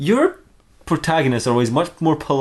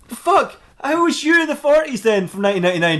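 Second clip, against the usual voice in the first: high-pitched mock bewilderment; baffled exasperation; 12 semitones higher than usual. Detective questioning the time-travelling mermaid, baffled. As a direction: play it flummoxed, voice pitched high.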